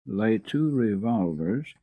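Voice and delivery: ordinary delivery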